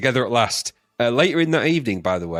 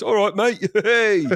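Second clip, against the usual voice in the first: high, cocky cockney greeting; cocky Yoda; 8 semitones higher, slower than usual. Acted. Imagining Yoda greeting a mate, cocky voice. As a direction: greet like a cheeky East End geezer. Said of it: "had that kind of sort of cocky voice".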